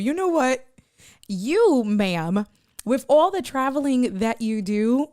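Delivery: addressing Gigi, loud mock indignation; teasing call-out. Real, not acted. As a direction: call her out, playfully.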